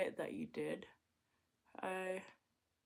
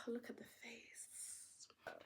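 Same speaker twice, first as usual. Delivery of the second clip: whisper; 3 semitones higher, 15 dB quieter, much slower than usual. Acted.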